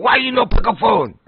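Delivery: high, mock broken-English complaint; mock Chinese accent. Delivery man complaining he didn't pick up, in a mock Chinese accent. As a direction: imitate the delivery guy's accent. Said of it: "He's like".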